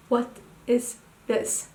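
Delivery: normal talking voice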